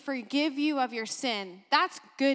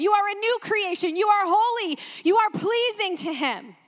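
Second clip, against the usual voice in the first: preaching that builds higher and more forceful; impassioned preaching; 4 semitones higher than usual. Real, not acted. Preacher telling believers who they are in Christ, impassioned preaching. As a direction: drive each declaration home with rising fervor.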